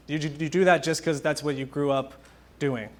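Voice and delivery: ordinary talk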